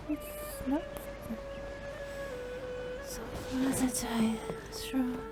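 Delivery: ordinary talk, nothing marked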